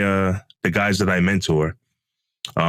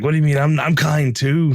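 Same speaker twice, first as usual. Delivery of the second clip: mock offended whine; 9 semitones higher than usual.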